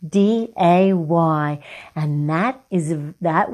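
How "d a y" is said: slow letter-by-letter spelling; spelled slowly. Shown as a demonstration. Teacher spelling day slowly for the class. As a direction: spell it out one letter at a time. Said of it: "You know how to spell day".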